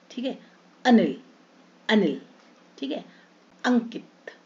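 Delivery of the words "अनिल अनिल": अनिल with a short अ at the start; short a sound. Shown as a demonstration. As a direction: say अनिल with a clipped अ. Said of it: "ए का अ". (अनिल में छोटा अ)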